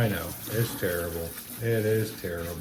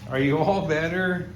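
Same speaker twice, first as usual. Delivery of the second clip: higher cooing question to the pup; higher pet-talk asking if he feels better; 7 semitones higher than usual. Real, not acted. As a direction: coo at him after grooming. Talking to the dog.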